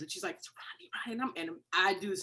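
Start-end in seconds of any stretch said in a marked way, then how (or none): 0.4-1.4 hushed, mock near-tears voice; almost in tears. Sister confides in the bathroom who phoned, almost in tears. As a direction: imitate someone choked up and barely voicing it. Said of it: "she's literally almost in tears".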